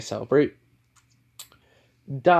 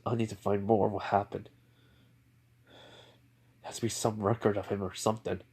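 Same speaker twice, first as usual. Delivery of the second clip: shaken muttering; 2 semitones lower, 9 dB quieter than usual. Acted. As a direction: mutter it privately, half in disbelief. Stunned father talking to himself.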